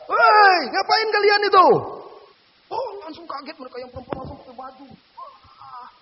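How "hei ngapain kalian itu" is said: much higher, loud, slow yell; shout. Retold or imitated. Ustaz catching the couple, shouting at them loudly. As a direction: bellow to catch them in the act.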